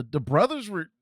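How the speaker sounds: normal talking voice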